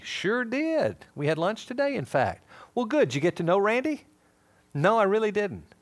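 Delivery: high, loud, cheery back-and-forth; upbeat acted chat. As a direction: play a breezy chat between friends. Acted chat with mutual friend.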